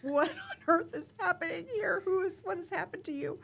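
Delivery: high, loud incredulous husband voice; playfully imitating a stunned husband; husband's mock disbelief. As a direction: ham up the husband's shocked disbelief. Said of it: "you have to know my husband is this very pan face expression".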